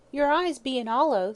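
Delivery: high, loud chattering landlady; sympathizing Cricket. A narrator's reading. Landlady to her shut-in lodger, sympathizing. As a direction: pitch it up, fussing with sympathy. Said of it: "said the sympathizing Cricket".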